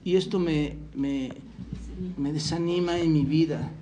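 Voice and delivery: softly voiced, downhearted excuse; mock discouraged complaint. Preacher voicing a discouraged church member's excuse, mock discouraged. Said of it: "No caigas en esa mentira de".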